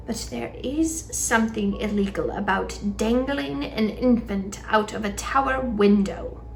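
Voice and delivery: usual speaking voice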